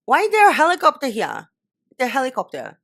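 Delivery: loud, high, disbelieving question; incredulous outburst. Real, not acted. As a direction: burst out at the noise. Annoyed at helicopter noise.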